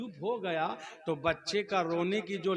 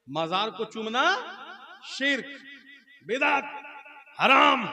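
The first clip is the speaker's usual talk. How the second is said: each word higher and louder; building sermon shout; 7 semitones higher, 6 dB louder than usual. Acted. Cleric's all-night sermon rising to a loud, high shout. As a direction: hammer the verdict home.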